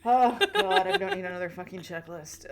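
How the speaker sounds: reacting to yet another checklist; exasperated oh God. Real, not acted. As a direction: groan it in disbelief.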